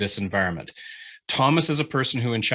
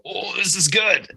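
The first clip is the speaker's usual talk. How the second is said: delighted reader; 9 semitones higher than usual. Retold or imitated. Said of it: "makes you go".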